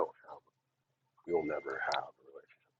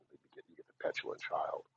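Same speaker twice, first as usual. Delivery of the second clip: ordinary talk, nothing marked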